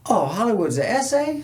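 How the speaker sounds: high-pitched retold surprise; surprised realization. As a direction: act out sudden astonishment, pitch swooping high. Retold fighter's surprised discovery.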